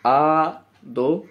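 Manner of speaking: आ drawn out long to show two beats; slow count. Shown as a demonstration. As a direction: stretch आ for the students. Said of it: "नंद को तोड़िए". (आ को लंबा खींचकर)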